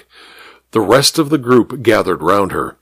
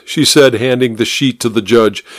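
plain reading voice

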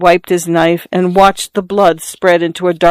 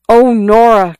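higher, louder cry of her name; boy crying out to the girl he loves; 5 semitones higher, 4 dB louder than usual. A narrator's reading. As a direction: call out to her in distress. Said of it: "cried the boy".

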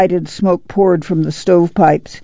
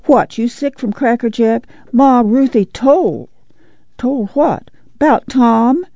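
lightly voiced boy; 6 semitones higher than usual. A narrator's reading. Child Winfield's urgent voice.

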